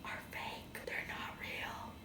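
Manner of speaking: soft unvoiced whisper. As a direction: whisper it like a secret. Whispering about fake movies.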